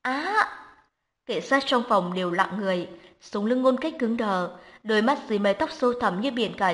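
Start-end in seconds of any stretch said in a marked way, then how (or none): 0.0-0.5 high, piercing scream of pain; burning scream. A narrator's reading. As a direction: shriek as if burned. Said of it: "tiếng hét thê lương".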